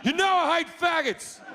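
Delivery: shrill outraged God; high-pitched God. Acted.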